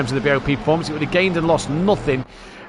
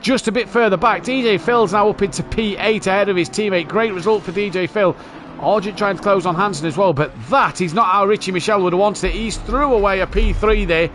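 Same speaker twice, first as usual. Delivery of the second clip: raised, lively race call; motorsport commentator; 4 semitones higher than usual. Real, not acted. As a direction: keep the live call going. Live race commentary continues.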